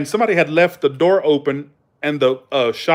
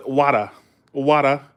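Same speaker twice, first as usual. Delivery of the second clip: imitated New England "Wada"; Northern accent, "wah-duh"; Massachusetts accent. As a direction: say "wada" with the R dropped. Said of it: "friend that talked like this".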